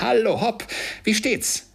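high, loud, exuberant greeting; joyful hail. A narrator's reading. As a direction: hail them in overflowing high spirits. Scrooge greets the arriving turkey. (übermütiger, lauter Gruß)

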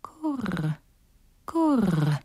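gentle dove coo. Acted. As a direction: coo like a wood pigeon. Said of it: "Da sagten die Holztauben".

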